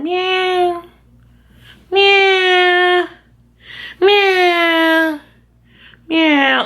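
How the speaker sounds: high imitated meowing; cat meows. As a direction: meow like a pestering cat. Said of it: "staring at me and going".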